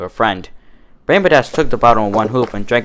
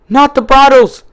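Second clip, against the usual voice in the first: high, loud cry; panicked shout; 7 dB louder than usual. A narrator's reading. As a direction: yell in alarm. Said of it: "she shouted".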